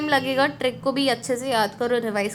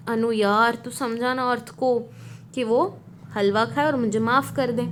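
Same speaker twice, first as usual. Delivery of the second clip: ordinary delivery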